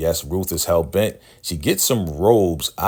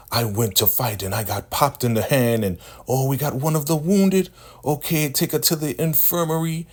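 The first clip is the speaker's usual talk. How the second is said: squeaky high-pitched scolding elder; high mocking elder voice; 7 semitones higher than usual. Recapping the show, mimicking the elder's high scolding voice. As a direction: play the bossy elder woman in a high squeak.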